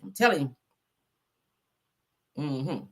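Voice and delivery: usual speaking voice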